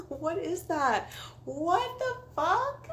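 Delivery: high, squealing real laughter through the words; bursting into laughter. Real, not acted. Cracking up at her own story.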